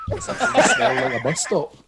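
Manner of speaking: squeaky high words through laughing; very high, laughing wheeze; helpless laughter. Real, not acted. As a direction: squeeze the words out while cracking up. Cracking up at own riff.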